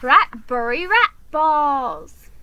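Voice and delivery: slow, loud, distraught rat voice; a panicked delivery rat wailing over spilled cargo; upset rat driver. Acted.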